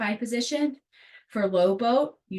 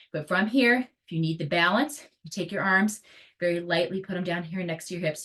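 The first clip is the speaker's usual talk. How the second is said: normal talking voice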